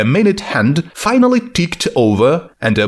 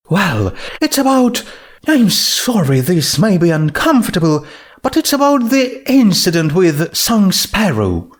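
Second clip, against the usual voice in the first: character voices; 7 semitones higher than usual. Acted. Twilight and Rarity voiced.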